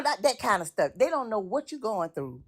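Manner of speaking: normal talking voice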